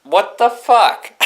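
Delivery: high, slow, laughing what the fuck; can't believe it, cracking up; laughing disbelief. Retold or imitated. Looking at dad as the truck starts, high laughing disbelief. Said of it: "I'm like".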